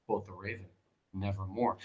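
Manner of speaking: soft, low, eerie Nevermore; hushed raven. Acted. Teacher voicing the raven to her class, hushed and low. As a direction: let the raven answer almost under the breath.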